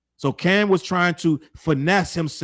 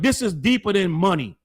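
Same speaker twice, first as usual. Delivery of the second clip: ordinary talk, nothing marked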